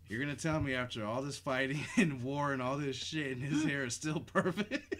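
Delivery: high, loud disbelief with laughs; incredulous laughing rant. Real, not acted. Incredulous rant about Brad Pitt's hair.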